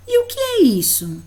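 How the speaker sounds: bem mais aguda, como quem pergunta a uma criança; pergunta animada de professora. Shown as a demonstration. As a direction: pergunte alto e agudo, com entusiasmo. (professora pergunta ao aluno, aguda e animada)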